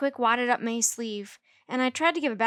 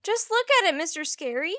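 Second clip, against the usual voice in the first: high, loud complaining whine; grouching; 10 semitones higher, 5 dB louder than usual. A narrator's reading. May grouching to her teacher about the sleeve, high and loud. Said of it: "she grouched".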